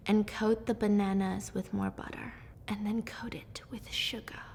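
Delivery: very soft whisper; whispered cooking narration. Real, not acted. Cook narrates coating bananas with butter and sugar, whispering. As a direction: whisper the recipe step softly.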